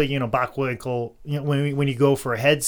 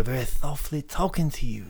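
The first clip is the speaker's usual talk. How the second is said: soft Dusty Rhodes; 5 dB quieter than usual. Shown as a demonstration. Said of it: "I do Dusty Rhodes like he does in Florida".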